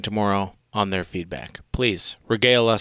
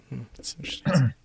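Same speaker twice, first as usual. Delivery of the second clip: sarcastic whisper; 3 semitones higher, 4 dB quieter, faster than usual. Real, not acted. Sarcastic aside.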